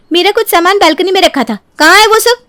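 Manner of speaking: high, urgent questioning; agitated demand. Acted. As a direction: demand your missing things back. Acted quarrel over missing belongings. (घबराई, तीखी ऊँची आवाज़ में सवाल)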